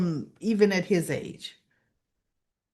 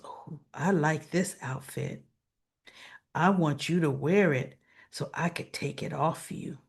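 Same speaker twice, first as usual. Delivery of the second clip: low, soft imitation of a flirt; retold seductive come-on; 4 semitones lower, 4 dB quieter than usual.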